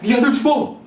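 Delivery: high, loud alarmist TV host; hammed-up TV health warning; health show alarm. Mom hearing a TV show, mock alarmist voice. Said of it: "이렇게 들리는 거예요".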